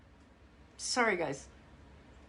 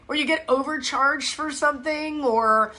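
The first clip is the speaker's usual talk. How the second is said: normal talking voice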